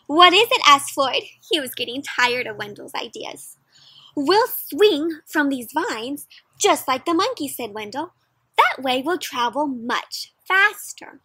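lively storybook read-aloud for a class; reading to children. Acted. Teacher reading a picture book aloud to her class, lively. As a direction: paint the story for the kids.

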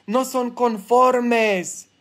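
ordinary delivery

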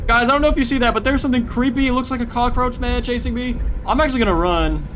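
high, loud, panicked report; breathless alarm while fleeing; being chased. Real, not acted. Running from creature, telling chat.

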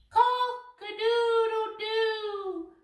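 slow, high sung rooster crow. Acted. Preacher imitates the cockerel's second crow, slow, high and sung. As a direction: stretch it out like a cockerel. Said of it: "the cockerel crowed".

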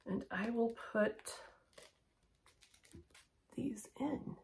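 unvoiced whisper while putting earrings in. Real, not acted. Vlogger putting in earrings, whispering. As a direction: breathe the words out, no voice. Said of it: "I don't know why I'm whispering".

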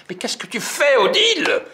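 high, loud alarmed shout; shrieking at Odile down the aisle. Acted. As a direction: yell across the store in panic. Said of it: "je crie".

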